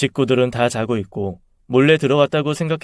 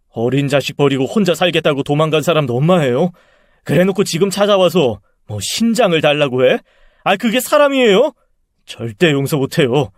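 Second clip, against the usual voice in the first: indignant outburst; 3 semitones higher, 4 dB louder than usual. Acted. Son telling his aunt about his mother, indignant outburst.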